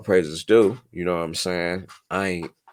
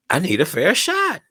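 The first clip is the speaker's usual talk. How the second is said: insistent; 12 semitones higher, 5 dB louder than usual. Real, not acted. Repeats louder, higher.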